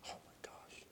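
breathless whispered aside; awed whisper. Real, not acted. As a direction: whisper it in quiet awe. Awed by the long Bible lesson.

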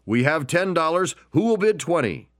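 high, ringing call for twenty; auctioneer calling. Acted. Auctioneer raising the bid.

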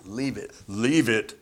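calm then firm, telling the dog off; firm dog command. Retold or imitated. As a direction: settle the dog, then insist. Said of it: "we're like".

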